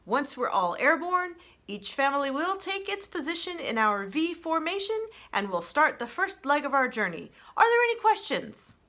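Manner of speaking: a goose leader briefing everyone before takeoff; lead goose's announcement. Acted. Goose leader addresses the flock.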